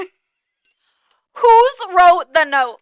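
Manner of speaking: very high, loud, drawn-out wail; dramatic catchphrase. Pretend. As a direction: stretch every word out.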